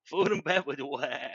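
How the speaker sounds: Elmer Fudd. Acted. Cartoon hunter hosting a cooking show, goofy impression. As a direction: echo it in a silly cartoon voice. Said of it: "like, Elmer Fudd making a cooking show".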